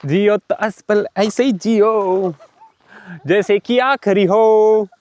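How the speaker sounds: filmy dialogue. Pretend. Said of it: "फिल्मी dialogue मार रहा हूं".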